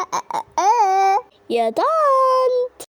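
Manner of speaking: stuttering, dragged out very slowly; mock stammer. Girl mocks how she'd stammer to the aunt. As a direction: fake nervous stammer, rising high. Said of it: "أنا رايحة أقول لها".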